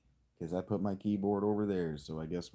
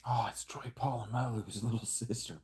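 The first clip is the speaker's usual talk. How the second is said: whisper. Real, not acted. Whispered joke aside.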